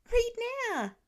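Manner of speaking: put-on very high squeaky child voice; a little kid demanding eagerly; little boy demanding his story; excited toddler. A narrator's reading. Child Nate voice.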